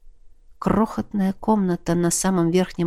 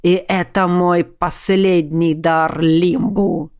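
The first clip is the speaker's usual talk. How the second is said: dying dragon; 3 semitones lower, slower than usual. A narrator's reading. Dying dragon's last words.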